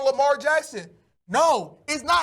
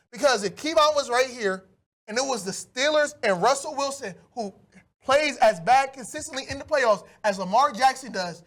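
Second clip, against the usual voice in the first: ordinary delivery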